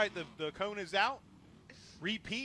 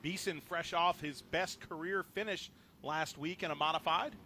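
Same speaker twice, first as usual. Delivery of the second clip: normal talking voice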